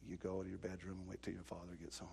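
hushed, fast stern mother; mom's whispered order. Retold or imitated. Mother sending the boy to his room, hushed and stern. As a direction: whisper the sentence like a grim verdict. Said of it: "and she goes".

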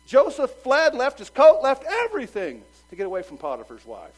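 loud, high, driving sermon cry; full-force preaching. Acted. Preacher urging young men to flee sin, at full force. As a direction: preach it out hard to the congregation.

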